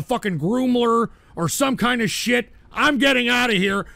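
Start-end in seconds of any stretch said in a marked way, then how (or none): none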